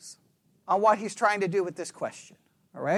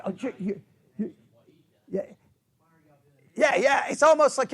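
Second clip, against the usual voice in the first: ordinary talk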